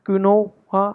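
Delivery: quinoa read as spelled, kwi-NO-ah; mispronounced quinoa. Shown as a demonstration. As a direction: say quinoa in separated syllables with an O. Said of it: "Some people might mispronounce". (quinoa को 'क्वि-नो-आ' बोलें)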